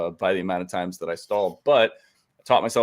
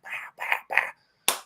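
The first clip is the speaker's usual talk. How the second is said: breathy imitated gear-shift noises; shifting sounds; 4 dB quieter than usual. Shown as a demonstration. Describing driving his Jeep, imitated shifting noises. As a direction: mouth the clunk of the shifter. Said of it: "So you just sit there and just".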